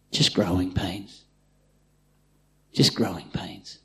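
put-on calm a frightened child; gentle reassurance. God answering the complaining believer, softly.

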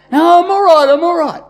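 loud, high stubborn insistence; loud brush-off. Retold or imitated. Pastor voices sick friend refusing help, loud stubborn brush-off.

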